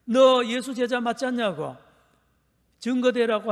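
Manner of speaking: loud, high accusing demand; accusing crowd. Retold or imitated. Preacher voicing the crowd confronting Peter, loud accusation. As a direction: press him like a hostile crowd. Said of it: "다시 이야기하는 겁니다".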